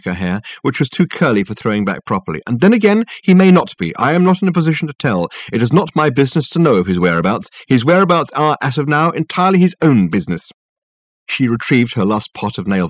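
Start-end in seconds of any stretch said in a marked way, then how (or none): 2.4-10.5 high, indignant secretary voice; officious huff, pitched up and emphatic; huffy secretary. Acted. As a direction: rattle off a haughty refusal to help.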